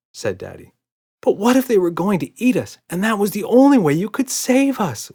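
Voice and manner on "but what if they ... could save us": high loud pleading wail. A narrator's reading. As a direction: wail it desperately. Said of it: "wailed Emma".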